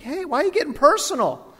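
high, hurt mock protest; indignant protest. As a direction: squeal it as a wounded comeback. Acts out protesting a customer's insults.

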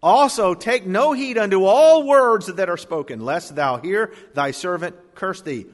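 preacher proclaiming the verse to the congregation; emphatic reading. Acted. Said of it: "Listen to this very carefully".

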